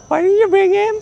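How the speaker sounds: high, loud indignant squeal; shrill outrage at the officers, hammed up; hammed-up angry priests; mock angry demand. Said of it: "with a voice like".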